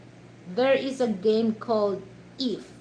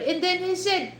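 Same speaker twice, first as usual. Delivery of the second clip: ordinary talk, nothing marked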